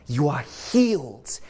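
ordinary delivery

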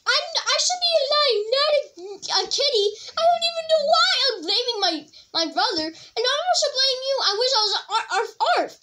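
high, giggly kitten voice with meows; playful kitty contestant. Pretend. Kid playing a kitty contestant in the pretend show, high giggly kitten voice. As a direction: mew and whine like a sulky kitten.

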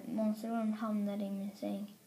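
ordinary delivery

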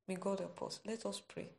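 ordinary talk, nothing marked

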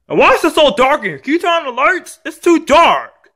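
put-on high-pitched childish demand, rushed then stretched; bratty complaint. Kid demanding lights from dad, bratty complaint. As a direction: whine high, drawing out the end.

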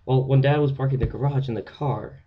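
a hint of an excited little boy; mimicking little brother. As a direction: blurt it out like an eager kid. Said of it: "my little brother said".

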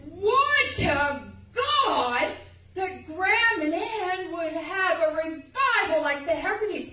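impassioned prayer shouted to God; loud prayer cry. Retold or imitated. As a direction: cry it out so the whole harbor hears. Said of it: "I think all Dark Harbor heard me".

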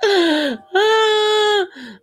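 long high wheezing laugh; high crying laugh. Real, not acted. Vlogger breaking down in high tearful laughter. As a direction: let a helpless tearful laugh out. Cracking up.

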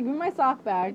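ordinary talk

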